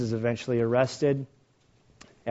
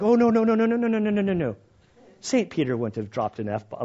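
mock scandalized commentators; 8 semitones higher than usual. Mocking commentators scandalized by Peter, high mock protest. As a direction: play the shocked prim commentator.

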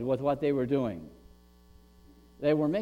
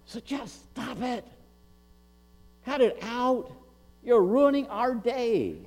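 higher-pitched exasperated telling-off; acted annoyance, raised pitch; crowd scolding; 5 semitones higher than usual. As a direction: tell off a nuisance as a fed-up crowd.

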